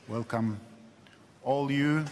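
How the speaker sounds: ordinary talk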